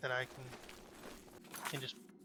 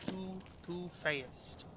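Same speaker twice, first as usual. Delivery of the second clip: slow, drawn-out repeat; 5 dB quieter, much slower than usual. Pretend. Player chides Ruin for rushing, slow drawn-out repeat. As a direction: stretch each word out very slowly. Repeats complaint, mockingly slowed down.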